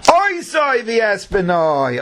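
slow, high, drawn-out stress on "oi soy the espenoi"; emphasis on OI SOY. Shown as a demonstration. Teacher showing the right emphasis, "oi soy" drawn out high. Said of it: "don't put the emphasis on the word oi, but rather say".

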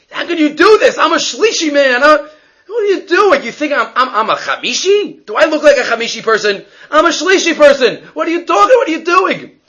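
high, loud outraged yell, with a laugh in it; indignant yelling. Retold or imitated. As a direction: yell at the gabbai, insulted and high-pitched. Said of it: "he starts screaming at the gabbai".

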